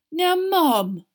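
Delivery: high, loud wounded protest; pained. A narrator's reading. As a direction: plead with mother as if hurt. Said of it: "a pained look on his face".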